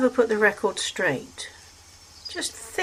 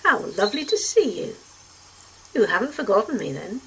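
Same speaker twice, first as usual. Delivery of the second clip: arch, flirty; 3 semitones higher than usual. A narrator's reading. Dasterium teasing the doctor on meeting again, archly coy. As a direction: lay on the charm teasingly. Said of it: "exaggeratedly fluttering her eyelashes".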